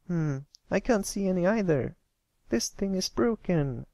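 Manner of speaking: a filly giving up on a telescope; tomboy filly. Acted. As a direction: declare it broken. Scootaloo peeks through telescope.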